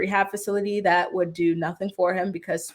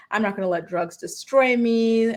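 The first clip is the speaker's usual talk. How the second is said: normal talking voice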